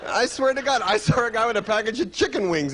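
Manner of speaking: usual speaking voice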